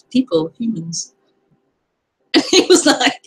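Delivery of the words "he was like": laughing. Real, not acted. Laughing at memory.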